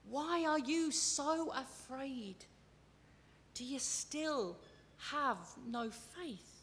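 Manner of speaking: slow, softening, searching question; gentle challenge. Shown as a demonstration. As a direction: ask it slowly, without criticism. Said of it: "as a challenge to grow".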